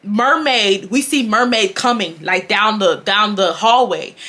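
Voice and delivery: ordinary talk, nothing marked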